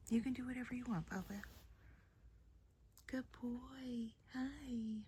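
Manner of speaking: soft, high, hushed whisper; cooing to a scared pet. Real, not acted. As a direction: whisper gently to a nervous cat. Whispering to the calm cat.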